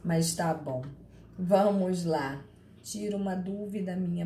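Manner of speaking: usual speaking voice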